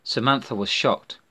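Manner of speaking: plain reading voice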